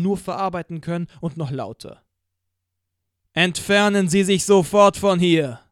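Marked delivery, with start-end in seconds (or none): 3.4-5.6 loud, higher, booming command over a PA; Lärm is no part of it, just a thundering megaphone order; thundering police loudspeaker. Acted. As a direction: order the crowd off like a voice from the sky. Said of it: "donnerte die Stimme Gottes".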